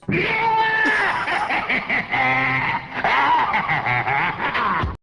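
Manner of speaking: long, high shrieking laugh; hysterical laugh. Real, not acted. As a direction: howl with uncontrollable laughter. Long laughing fit at the story.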